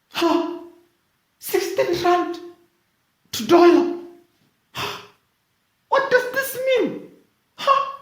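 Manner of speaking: squeaky high baffled questions; high confused voice. Pretend. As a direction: sound utterly lost, squeaking. Voicing confused public.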